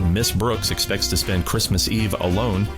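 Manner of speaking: normal talking voice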